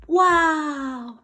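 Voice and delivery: high, loud "Wow!"; amazed wow. Shown as a demonstration. Teacher modelling awe at an elephant, high and loud. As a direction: marvel at the huge elephant.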